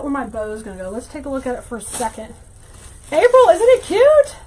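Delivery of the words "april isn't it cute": high and loud, gushing over the wreath; excited. Real, not acted. Host asks a viewer to admire the wreath, gushing. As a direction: show it off with a squeal of delight.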